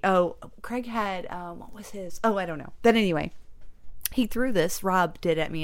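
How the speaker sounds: ordinary delivery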